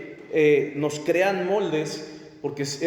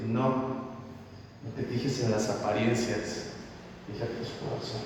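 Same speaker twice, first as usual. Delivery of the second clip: God's gentle answer; 9 semitones lower, 9 dB quieter than usual. Retold or imitated. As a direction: hush it like God correcting Samuel. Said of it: "le dijo Dios".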